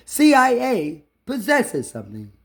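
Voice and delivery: ordinary talk, nothing marked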